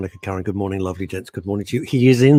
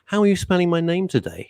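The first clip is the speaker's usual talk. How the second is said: higher, miffed quoted guest; mock upset guest; 8 semitones higher than usual. Guest mocked for name misspelt, voiced slightly miffed. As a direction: lightly voice the offended guest. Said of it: "and he goes".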